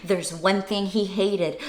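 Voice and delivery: lively read-aloud storytelling voice; reading to children. A narrator's reading. Teacher reading the Grinch to children, lively and animated. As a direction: read it bright and animated for little listeners.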